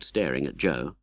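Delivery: ordinary delivery, nothing marked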